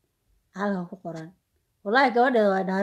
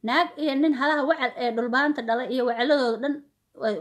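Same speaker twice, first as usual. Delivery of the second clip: ordinary talk, nothing marked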